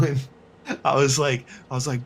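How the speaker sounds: ordinary talk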